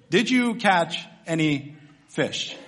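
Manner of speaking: each word spaced out, dropping on "fish"; slow, deliberate question. Acted. Preacher repeats the humiliating question to empty-handed fishermen, slowly and deliberately. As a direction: draw out "Did you catch any fish" word by word.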